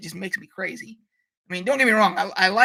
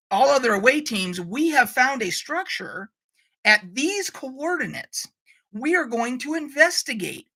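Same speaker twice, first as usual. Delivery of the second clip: slow, higher, deliberate radio message; communicator call; 4 semitones higher than usual. Acted. Captain hailing all other away teams, slow communicator call. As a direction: announce it slowly over the communicator. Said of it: "I'm tapping my thing here, my communicator saying".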